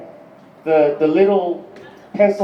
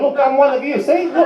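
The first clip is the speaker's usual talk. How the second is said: high, eager appeal; acted anxious insistence, pitched up; mock pleading; 7 semitones higher than usual. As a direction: plead your case in a raised voice. Acted plea to a skinhead.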